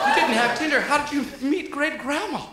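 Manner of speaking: high mock-kid interrogation; astonished great-grandchild. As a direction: play an incredulous child quizzing papa. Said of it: "running up to their great-grandfather like".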